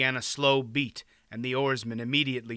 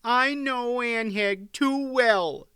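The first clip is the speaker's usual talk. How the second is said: high, slow, disapproving wife; high-pitched huffy reply; sniffy queen; 10 semitones higher, 4 dB louder, slower than usual. A narrator's reading. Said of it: "the queen sniffed".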